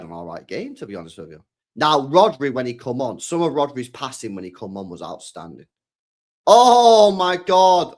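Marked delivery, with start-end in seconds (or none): 6.5-7.9 loud, high, stretched exclamation; excited outburst. Real, not acted. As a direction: burst out high and loud in amazement. Reacting to a skill move live.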